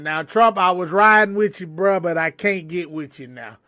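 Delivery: usual speaking voice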